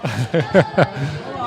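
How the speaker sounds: brief embarrassed chuckle; short laugh. Real, not acted. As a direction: laugh at yourself quickly. Laughs at own mix-up.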